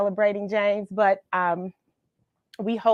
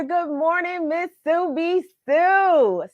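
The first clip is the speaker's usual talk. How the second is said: singsong greeting; 8 semitones higher, slower than usual. Real, not acted. Host greeting a viewer warmly.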